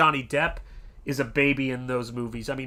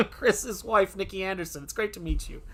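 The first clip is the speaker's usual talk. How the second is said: second reading; 6 semitones higher than usual. Pretend. Host redoes the listener greeting, higher laughing voice. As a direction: giggle through a lighter put-on hello. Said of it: "That's my reading".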